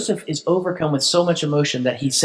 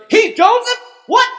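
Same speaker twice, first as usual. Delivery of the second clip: much higher, louder shocked yelp; stunned disbelief; 5 dB louder than usual. Retold or imitated. A brother hears the ruler is Joseph, high shocked yelp. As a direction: squeak it out in total shock.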